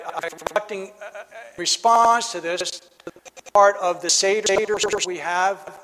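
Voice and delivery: ordinary delivery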